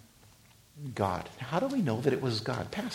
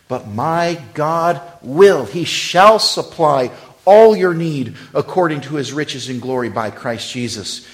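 preached scripture; 3 semitones higher, 13 dB louder than usual. Acted. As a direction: thunder the verse out. Proclaiming verse.

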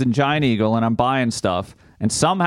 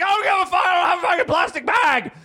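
screaming. Retold or imitated. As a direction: scream it at the cashier over the bag. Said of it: "I'm gonna be screaming out".

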